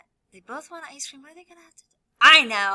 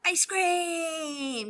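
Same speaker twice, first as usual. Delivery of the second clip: excited; 7 semitones higher, 5 dB louder than usual. Acted. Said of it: "they're gonna be saying".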